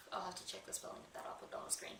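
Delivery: quick whispered note to herself; whispered aside. Real, not acted. Noting to herself she'll fix the spelling, whispered aside. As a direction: whisper it fast, as a private reminder.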